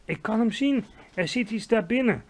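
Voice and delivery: high, eager exclamation; excited cry. A narrator's reading. Sophie peering into the glass pot, excited cry. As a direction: call out a sudden discovery.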